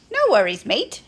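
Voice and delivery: loud, cheery mock Australian accent. A narrator's reading. Schubert reassuring Big Benny, Australian accent. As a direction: put on a breezy Australian accent. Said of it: "replies in an Australian accent".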